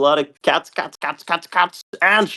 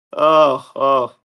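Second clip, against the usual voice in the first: ordinary delivery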